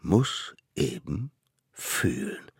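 slow, fading to a near whisper; ominous judge. A narrator's reading. Mammoth court gives up on the stubborn child, ominous. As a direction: drop it slowly and menacingly.

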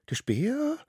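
very high, rising squeak of a question; startled little caveboy. Acted. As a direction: squeak it as a disbelieving little one. Little wild one in a story. (hoch piepsendes Kind)

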